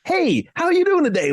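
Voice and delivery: high sing-song neighbour greeting; kooky cheery neighbour. Retold or imitated. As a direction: greet like an oddly chirpy local. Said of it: "kooky people ... still like".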